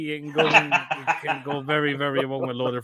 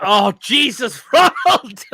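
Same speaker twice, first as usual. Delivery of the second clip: high, loud yell breaking into laughter; shrieked, laughing outburst; appalled shout; 9 semitones higher, 10 dB louder, slower than usual. Real, not acted. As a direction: cry out in horrified amusement. Appalled laughing reaction to picture.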